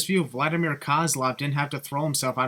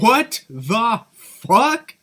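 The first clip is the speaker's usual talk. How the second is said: drawn-out, loud, outraged; outraged disbelief; 7 semitones higher, 8 dB louder, much slower than usual. Real, not acted. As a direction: stretch it out in shocked outrage. Outraged at the commentary.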